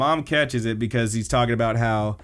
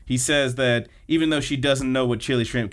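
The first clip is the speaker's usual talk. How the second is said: ordinary talk, nothing marked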